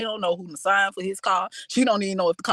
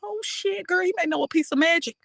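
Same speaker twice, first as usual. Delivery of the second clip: mock shocked friend; 8 semitones higher than usual. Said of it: "I would be like".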